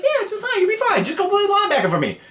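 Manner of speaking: squeaky falsetto, far above normal; mock reassurance. Coach sending a player in, comic falsetto reassurance. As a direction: brush off the worry in a comic high squeal.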